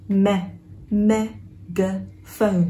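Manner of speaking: "megaphone" syllable by syllable, starting "me" with open e; very slow, separated "megaphone"; slow syllable demo. Shown as a demonstration. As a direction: draw out "megaphone" slowly as "me-ga-phone". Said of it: "âm e".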